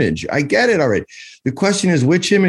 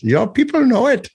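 a lightly put-on British accent, high and smug; a quick playful British accent to close the bit; mock British accent; 11 semitones higher than usual. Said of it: "at least make us sound British".

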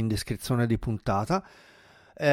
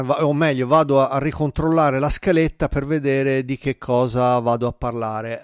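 usual speaking voice

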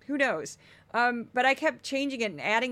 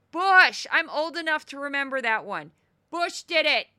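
loud, higher, worked-up correction; yelling; 4 semitones higher, 6 dB louder than usual. Real, not acted. Yelling at Laura through the screen, fired up. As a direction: shout at the TV screen. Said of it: "I'm yelling".

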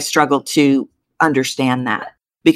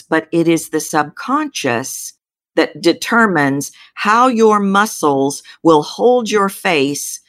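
usual speaking voice

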